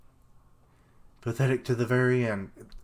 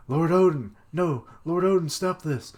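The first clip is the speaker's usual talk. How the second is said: high, loud pleading; desperate plea; 6 semitones higher, 5 dB louder than usual. Retold or imitated. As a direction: beg him to stop. Voicing vassals crying out.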